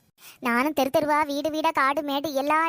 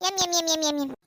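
savouring hum. Acted. Mother savouring the curry.

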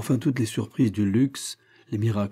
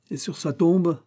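mocking, pitched well up; a sneering, ironic question; sardonic; 8 semitones higher than usual. A narrator's reading. Émile taunts the rich murderer, sardonic. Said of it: "d'un ton sardonique".

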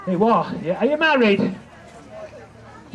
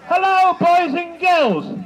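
higher, slow, loud panto greeting; panto callback; 6 semitones higher, 3 dB louder, much slower than usual. Shown as a demonstration. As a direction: call out to the kids slowly. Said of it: "we'll try again".

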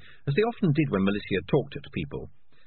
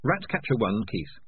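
ordinary delivery

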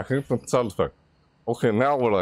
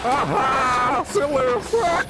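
panicked screaming; much slower than usual. Real, not acted.

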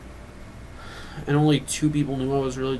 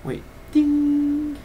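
high ding sound; 11 semitones higher than usual. Acted. Intro bell sound.

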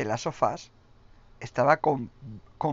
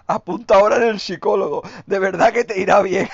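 louder, higher mocking jab; taunting; 5 semitones higher, 9 dB louder than usual. Real, not acted. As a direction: needle the haters. Taunting critics to see a psychologist. (burlándose con sorna)

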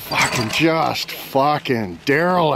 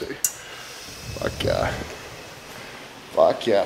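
ordinary delivery

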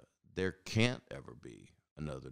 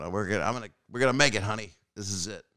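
Ralph Kramden; 5 semitones higher, 6 dB louder, faster than usual. Retold or imitated. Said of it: "Ralph Kramden going".

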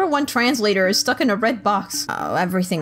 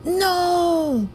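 shocked no; 8 semitones higher than usual. Real, not acted. Shocked at dying in game.

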